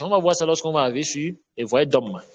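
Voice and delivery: normal talking voice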